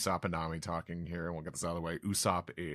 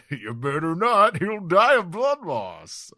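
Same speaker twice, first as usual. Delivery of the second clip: much higher and louder, slow at the end; giant warning; 11 semitones higher, 12 dB louder than usual. Acted. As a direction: warn him in a big booming voice. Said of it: "Brogy over here says".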